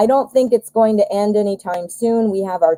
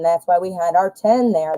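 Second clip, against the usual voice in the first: ordinary delivery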